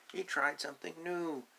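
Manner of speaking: usual speaking voice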